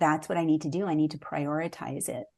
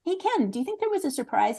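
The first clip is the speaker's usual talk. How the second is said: quick, bright question pitched way up; eager question; 10 semitones higher, faster than usual. Retold or imitated. Asking her husband Ken about surprises, quick and high. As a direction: toss the question at him, fast and lifted.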